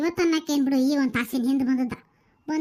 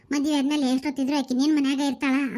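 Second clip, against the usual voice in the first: normal talking voice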